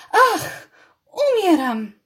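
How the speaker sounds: high, loud melodramatic death squeak; hammed-up dying. A narrator's reading. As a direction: overact a stage death. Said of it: "pisnęła Viola".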